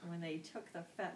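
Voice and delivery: usual speaking voice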